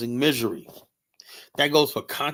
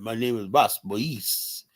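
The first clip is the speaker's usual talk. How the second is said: slow, lightly put-on French accent; mock French accent; 2 semitones lower, slower than usual. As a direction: draw out the name in mock French. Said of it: "With that French accent".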